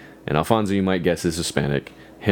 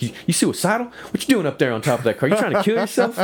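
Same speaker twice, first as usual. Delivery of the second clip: high, mocking Southern cop voice; 12 semitones higher, faster than usual. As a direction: cop grilling a kid on a car roof, mock Southern voice. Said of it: "fantastic racist cop vibe".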